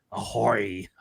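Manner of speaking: low, gruff pirate greeting. Acted. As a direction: growl it like an old sea pirate. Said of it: "the pirate voice in there".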